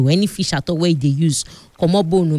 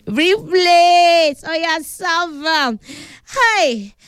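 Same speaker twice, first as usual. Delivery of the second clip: calling out; 10 semitones higher, slower than usual. Real, not acted. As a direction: shout across the house for plates. Calling out to household to bring plates.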